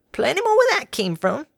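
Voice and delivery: put-on high, cheery elderly mare; a sweet old farm granny offering water. A narrator's reading. Granny offering water to the workers, high and cheery.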